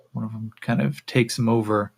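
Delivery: ordinary talk, nothing marked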